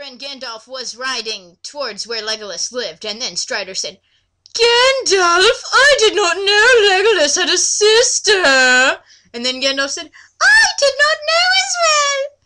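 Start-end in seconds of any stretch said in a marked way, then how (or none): 4.5-9.0 high, loud, strained hero voice; Strider voice. Acted. Strider asking Gandalf about Legolas, high and loud. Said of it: "I'm going ever with the voices here". 10.4-12.3 very high, loud squeaky wizard; Gandalf voice. Acted. Gandalf answering Strider in a very high, loud squeak. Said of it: "I'm going ever with the voices here".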